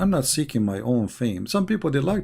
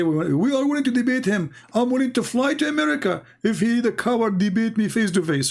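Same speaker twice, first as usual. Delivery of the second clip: much higher, imitating boastful challengers; hammed-up high-pitched boast of wanting a fight; mock bravado; 9 semitones higher than usual. Opponents claim they're willing to debate him, mock bravado.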